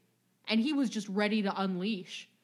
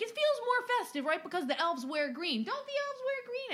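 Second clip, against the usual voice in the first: mock eager question; 12 semitones higher than usual. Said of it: "he was like".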